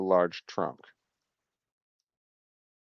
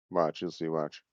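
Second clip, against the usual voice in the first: hushed tease; 6 semitones lower, 10 dB quieter than usual. Retold or imitated. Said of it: "You're like".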